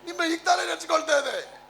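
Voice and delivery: high-pitched put-on wifely surprise; mock doting wife. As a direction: imitate a wife feigning longing. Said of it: "ತಿರ್ಗಾ ಹೇಳ್ತಾಳೆ". (ಹೆಂಡತಿಯ ನಾಟಕೀಯ ಅನುಕರಣೆ)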